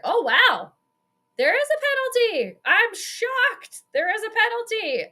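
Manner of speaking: sarcastic, high-pitched fake astonishment; mock shock. Real, not acted. Fan mocking the refs for finally calling a penalty, sarcastic high surprise. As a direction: squeak it out like you can't believe it. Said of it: "Call me shocked here, people".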